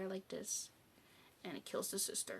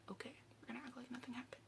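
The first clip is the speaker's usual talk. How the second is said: very soft, rapid whisper; hushed agreement; 4 semitones higher, 13 dB quieter, much faster than usual. Retold or imitated. He agrees with her to keep quiet, hushed. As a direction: whisper it back quickly, as if in on the secret. He answers her, dying or complicit.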